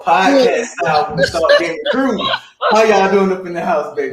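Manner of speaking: loud, high shouted hype-man intro; big booming announcer entrance; hype host intro. Acted. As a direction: blast it like a stage MC. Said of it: "He does that all the time".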